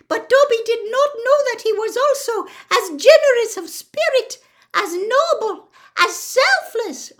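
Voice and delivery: overwhelmed, very high, loud squeaky elf; Dobby. A narrator's reading. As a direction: gush with tearful admiration as a little elf. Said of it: "his eyes brimming with tears again".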